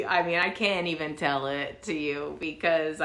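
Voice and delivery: normal talking voice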